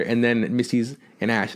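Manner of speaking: ordinary talk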